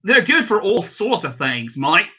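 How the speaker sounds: a light Australian accent, showing how it should go. Shown as a demonstration. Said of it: "You're supposed to do the Australian accent".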